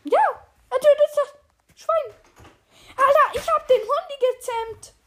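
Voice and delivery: high, loud, delighted cry; real excitement. Real, not acted. Player watches the new dog attack a pig, thrilled. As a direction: exclaim it, overjoyed.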